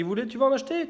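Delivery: imitated vendor. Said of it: "Et là, tu dis".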